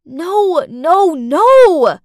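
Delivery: loud, high desperate denial; screaming no. Acted. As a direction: scream it in panic. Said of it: "I screamed".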